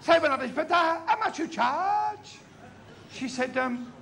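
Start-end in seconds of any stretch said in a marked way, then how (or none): 0.7-2.4 high, slow comic haggling question. Acted. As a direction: stretch the price question out high.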